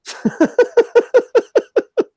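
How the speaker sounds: loud high-pitched laugh burst; high cackle. Real, not acted. Laughs after insult.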